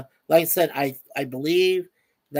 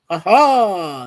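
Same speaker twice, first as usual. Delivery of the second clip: excited; 2 semitones higher, 9 dB louder than usual. Pretend. Cheering a viewer's name.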